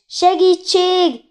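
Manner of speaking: high, loud, drawn-out cry; cry for help. A narrator's reading. A woman calling the hotel for rescue, high shouted cry for help. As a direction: call out for help, stretching the word. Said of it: "harsant fel egy kiáltás".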